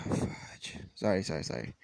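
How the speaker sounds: embarrassed mutter. Real, not acted. Embarrassed after botching the accent.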